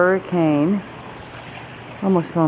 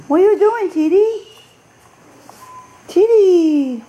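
much higher, louder coo; pet voice; 13 semitones higher, 5 dB louder than usual. Real, not acted. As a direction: coo at the kitty. Cooing to the cat.

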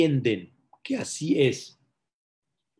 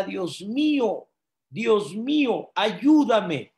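high, drawn-out cry for help; 7 semitones higher, 3 dB louder, slower than usual. Acted. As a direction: plead to God from the end of a rope. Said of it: "Y en eso grita". (grito de auxilio)

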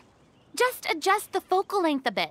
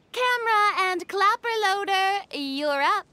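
usual speaking voice

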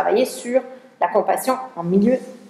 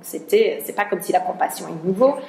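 normal talking voice

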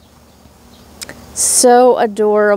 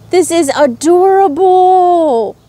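excited; 7 semitones higher, 3 dB louder than usual. Real, not acted. Unboxing mystery bag.

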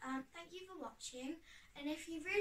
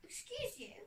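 mock offended whisper; 10 semitones higher than usual. Real, not acted. Cat interrupts her.

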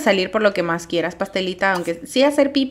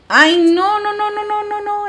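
panicked no's; 11 semitones higher than usual. Real, not acted. Player panics as trouble hits her sim. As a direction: plead no again and again, fading. Panicked over game event.

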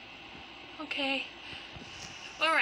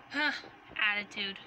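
ordinary talk

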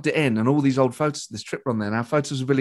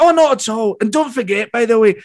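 higher, louder imitation of a famous comedian; Billy Connolly; 7 semitones higher, 4 dB louder than usual. Famous comedian detaining two fans with advice, voice imitated. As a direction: wave off the apology and carry on advising, in his voice. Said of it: "He goes".

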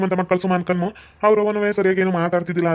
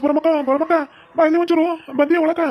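higher calls to a neighbour; calling out; 7 semitones higher than usual. Acted. Man calling the neighbour woman to come quickly, higher calls. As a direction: hail her from across the lane, higher and rising. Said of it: "ಯಾಕಪ್ಪ ನನಗೆ ಕೂಗಕತ್ತಿ".

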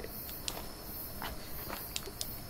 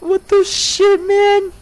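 startled cry; 11 semitones higher, slower than usual. Real, not acted. Startled by noise.

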